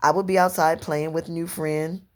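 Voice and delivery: usual speaking voice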